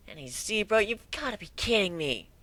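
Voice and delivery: put-on high, excited young colt; a bright kid pony, awed then teasing; young pegasus voice. Whiplash incredulous.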